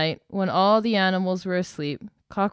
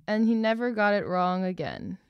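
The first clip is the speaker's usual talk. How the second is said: ordinary delivery, nothing marked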